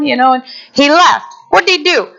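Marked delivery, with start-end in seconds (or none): none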